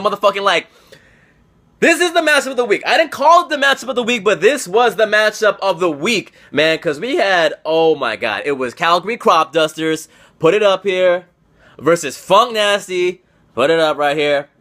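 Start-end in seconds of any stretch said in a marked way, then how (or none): none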